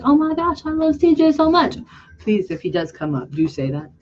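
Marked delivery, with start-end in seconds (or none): 0.0-1.8 high, gushing "Oh my gosh"; gushing fan. Pretend. Viewers gushing over C J to his face. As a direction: gush like a starstruck fan. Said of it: "you guys can be like".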